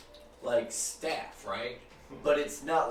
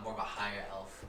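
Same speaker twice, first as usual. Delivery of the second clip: normal talking voice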